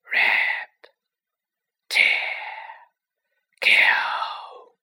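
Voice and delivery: unvoiced, menacing whisper; cold murderous whisper. A narrator's reading. Unseen monster whispers its hunger to kill. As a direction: hiss each word like a hidden killer. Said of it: "same cold, murderous voice".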